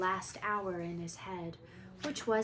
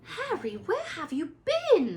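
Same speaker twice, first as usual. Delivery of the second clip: squeak; 9 semitones higher, 8 dB louder than usual. Acted. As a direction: squeak it out in relief. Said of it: "Hermione squeaked".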